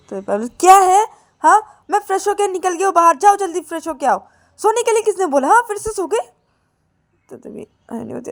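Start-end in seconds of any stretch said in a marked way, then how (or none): 0.6-6.3 high, loud sister voice calling to wake her; shrill-pitched nagging wake-up calls; Payal waking sister. Acted. Payal wakes sleeping Ananya, loud high nagging.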